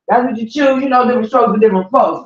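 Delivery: normal talking voice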